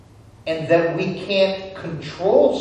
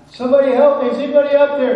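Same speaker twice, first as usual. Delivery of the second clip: high, loud cry for help from a pit; calling out; 11 semitones higher, 9 dB louder, much faster than usual. Acted. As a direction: holler up to anyone who might hear. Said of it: "he's calling out".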